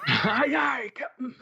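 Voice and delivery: high-pitched mock crew cheer; playful crew reply. Friend answering the pirate captain's call, playful high cheer. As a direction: chime back like eager cartoon kids.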